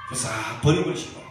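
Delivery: ordinary delivery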